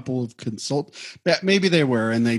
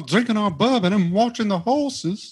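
exaggerated Southern accent; 8 semitones higher than usual. Co-host imitating the film's Louisville character, hammed-up Southern accent. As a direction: ham up a movie Southerner. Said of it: "it is very close to that, how he talks".

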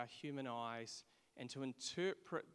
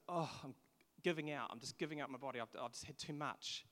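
ordinary talk, nothing marked

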